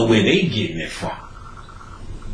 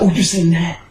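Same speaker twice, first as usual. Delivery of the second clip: mock excited viewer; 6 semitones higher than usual. Mocking excited movie viewers.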